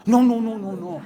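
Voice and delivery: loud, high, rushed string of no's; alarmed refusal. Retold or imitated. The mother recoiling from the idea, loud alarmed no's.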